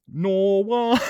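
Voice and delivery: mock her own broad Aussie "Norway"; Queensland accent. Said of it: "I sound like I'm from Queensland".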